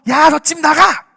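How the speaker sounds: loud, high parental yell; angry parent. Acted. Parent kicking the child out, angry yell. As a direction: order the child out at full volume. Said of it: "부모가".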